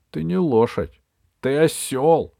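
ordinary delivery, nothing marked

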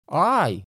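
sharp high yelp of pain; pained cry. Acted. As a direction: cry out as if hit hard. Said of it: "вдруг вскрикнул от боли".

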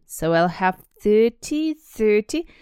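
slow, loud, halting count; Dudley slowly working out a sum; slow thinking. Acted. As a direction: count it out as if it's hard work. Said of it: "he said slowly".

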